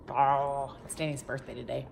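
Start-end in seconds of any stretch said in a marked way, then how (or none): none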